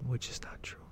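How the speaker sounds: soft unvoiced whisper; whispered aside. Real, not acted. Commenter correcting the movie under his breath, whisper.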